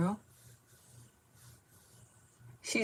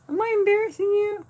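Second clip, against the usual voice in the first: much higher, squeaky and loud; 8 dB louder than usual. Pretend. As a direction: tease the dog in a squeak.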